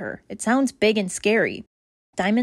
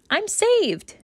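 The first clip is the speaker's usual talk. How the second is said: high, bright cry of relief; freed puppy spinning in circles, crying out happily; joyful cry; 11 semitones higher than usual. Acted. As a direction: burst out with joy.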